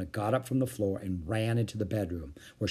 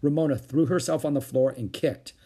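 ordinary delivery

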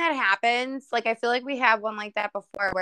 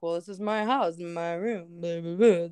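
mock the dad with a low drone; lightly voiced grumbling dad; 7 semitones lower than usual.

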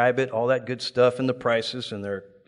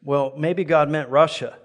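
normal talking voice

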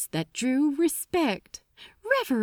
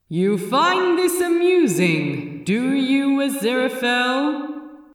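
loud, sharp demand; sharp rebuke; 4 semitones higher, 5 dB louder than usual. Acted. The Metatron rebuking Aziraphale for laughing, sharp and loud. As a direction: snap the question at him. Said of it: "said the Metatron sharply".

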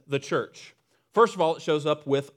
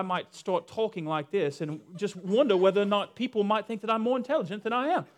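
mock British accent, pitched up; 5 semitones higher than usual. Shown as a demonstration. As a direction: put on a British accent to sound more intelligent. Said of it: "if you have a British accent".

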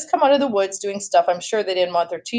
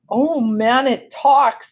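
mocking scream; 5 semitones higher, 4 dB louder, much slower than usual. A narrator's reading. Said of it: "screamed Eddie".

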